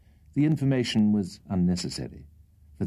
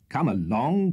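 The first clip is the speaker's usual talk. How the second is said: higher, hearty call across the trees; shout; 6 semitones higher, slower than usual. Acted. Old gentleman hails the late Mister Winkle, a light shout. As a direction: hail him from a distance. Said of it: "shouted the old gentleman".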